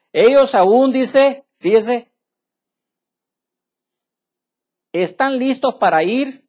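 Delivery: loud, emphatic, slowed scripture reading; fiery preaching. Acted. Pastor rereading scripture to his church, forceful. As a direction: hammer the verse home.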